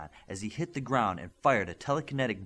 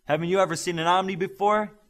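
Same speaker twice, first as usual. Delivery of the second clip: loud, higher shout to the stands; yelled; 9 semitones higher, 7 dB louder than usual. A narrator's reading. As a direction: throw it at the whole crowd. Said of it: "he yelled at the crowd".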